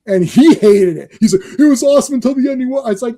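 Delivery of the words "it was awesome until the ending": lightly imitated protest, higher and fast; mock complaint. Host retelling a friend's complaint about Cube, mock complaint. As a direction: rattle off a friend's grievance. Retold argument over Cube's ending.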